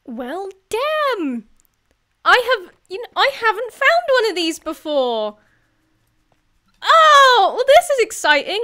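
loud, high burst of excitement; high-pitched, loud amazement; real excitement. Real, not acted. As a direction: exclaim it, thrilled by a rare find. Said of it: "Sorry, I'm getting excited".

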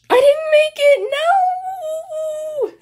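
high, loud squealed cry; dramatic wail of defeat. Real, not acted. Player losing the round, high dramatic wail.

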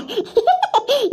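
high-pitched giggle. Acted. Friend laughing off her own teasing, high giggle. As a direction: let out a short, high giggle.

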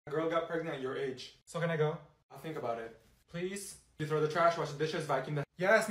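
ordinary talk

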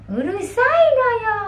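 high, sharp annoyed snap; angry yo. Retold or imitated. Child snapping at a noisy sibling, pitched high and annoyed. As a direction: scold someone for being noisy. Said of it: "may yo yun pag galit ka".

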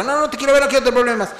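high, whiny mock refusal from the ex; a hint of a panicky dodger; mock ex-partner. Voicing the evasive ex-partner.

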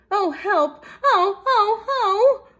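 high, very loud wails; frantic storybook mouse; panicked cries. A narrator's reading. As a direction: wail for help in rising alarm. Mouse flees crying for help.

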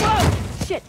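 sudden loud high yelp; startled whoa. Acted. Partner slips and yelps in shock, loud. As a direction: cry out as the ground gives way.

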